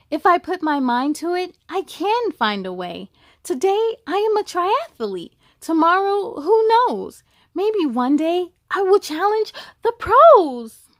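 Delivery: put-on a triumphant girl with her medal, building up; proud excitement. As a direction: let her pride swell line by line. Proud winner dreaming big.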